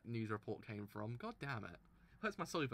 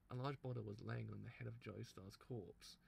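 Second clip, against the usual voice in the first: normal talking voice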